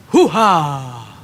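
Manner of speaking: loud hammy movie-star shout; Al Pacino impression. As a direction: belt it like a tough movie star. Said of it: "you do a killer Al Pacino impression".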